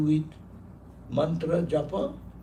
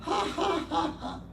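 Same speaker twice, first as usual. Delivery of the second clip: high mock laugh, ha ha; monk's laughter; 11 semitones higher than usual. Monk laughing at Alexander's sword, high laughter. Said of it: "burst into laughter".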